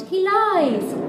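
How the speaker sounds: high, loud warning; warning seashells. A narrator's reading. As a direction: blurt out the alarm. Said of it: "and the seashells warned".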